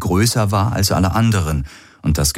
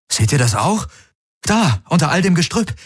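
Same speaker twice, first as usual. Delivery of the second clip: high, excited exclamation; excited calling; 11 semitones higher than usual. A narrator's reading. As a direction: point out a sudden find, pitched high. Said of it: "rief er aus". (aufgeregt und hoch rufen)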